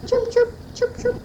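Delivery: put-on high tweeting chirps for toddler; bird chirp. Shown as a demonstration. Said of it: "how does a bird go?".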